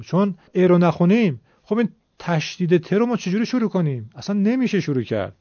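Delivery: ordinary talk, nothing marked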